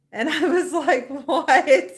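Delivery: laughing incredulous what. Retold or imitated. Retelling reaction to foot massage.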